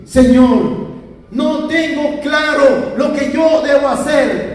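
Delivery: high, loud, drawn-out pleading prayer; desperate prayer. Retold or imitated. Man retells kneeling before the Lord, impassioned high plea. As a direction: cry out to God, pressing every phrase. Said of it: "me arrodillo en mi cama y le digo así".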